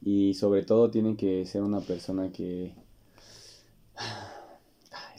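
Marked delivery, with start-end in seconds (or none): 3.9-5.2 a long audible sigh; deep sigh. Real, not acted. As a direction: breathe out a heavy sigh, ay.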